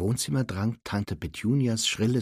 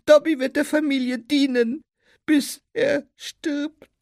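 high, plaintive squeaky elf; Dobby; 8 dB louder than usual. A narrator's reading. Elf laments he will never be freed, plaintive squeak. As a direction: squeak it mournfully, in drawn-out phrases.

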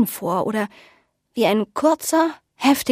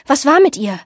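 pressing, louder, higher query; quick question; 5 semitones higher, 6 dB louder than usual. A narrator's reading. Marie calling after the old woman, urgent question. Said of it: "fragte Marie schnell".

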